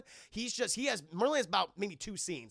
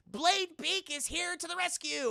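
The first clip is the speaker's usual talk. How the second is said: high, hammed-up heroic rescuer voice; heroic chicken voice; 10 semitones higher than usual. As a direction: announce the rescue like a cartoon hero. Chicken villain switches sides.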